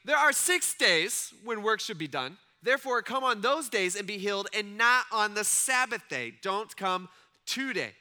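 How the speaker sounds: loud, pointed announcement to the crowd; passive aggressive leader. Retold or imitated. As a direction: address the crowd so Jesus overhears. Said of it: "this is what he says".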